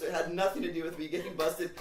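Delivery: ordinary talk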